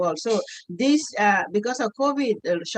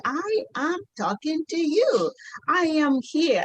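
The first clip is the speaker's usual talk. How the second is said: reassuring son; 6 semitones higher, slower than usual. Retold or imitated. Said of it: "He said".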